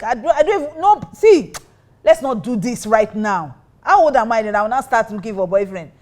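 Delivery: retelling how she panicked and argued with God; frantic protest. Retold or imitated. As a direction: plead with God, flustered and overwhelmed. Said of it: "I was just going on and on".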